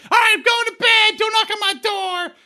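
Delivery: very high, hammed-up kid voice; high-pitched bratty son caricature; mocked son voice. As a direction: play the son calling out to dad in a high squeal. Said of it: "your son banding it about behind you".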